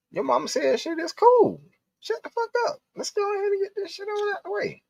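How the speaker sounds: very high, strained mock girl voice; high squeaky girlfriend. Girlfriend urging him on after the moms leave, shrill and high. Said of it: "I was like".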